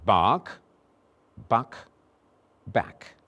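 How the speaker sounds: bark, buck, back said slowly and separately; minimal pair drill. Shown as a demonstration. Teacher drilling vowels for the class. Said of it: "Do you want to hear them again".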